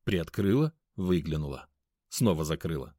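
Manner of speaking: ordinary delivery, nothing marked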